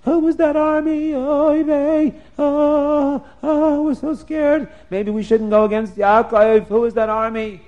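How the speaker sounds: high, loud frightened wail; Esav's beaten men wailing in fright; scared wailing. Acted. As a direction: wail in panic after the beating. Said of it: "crying and screaming".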